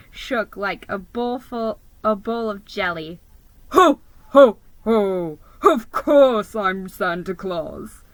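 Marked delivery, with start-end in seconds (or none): 3.7-8.0 deep, booming Santa. A narrator's reading. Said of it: "in a deep, booming voice".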